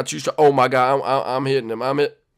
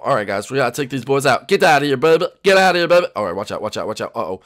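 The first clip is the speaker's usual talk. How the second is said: normal talking voice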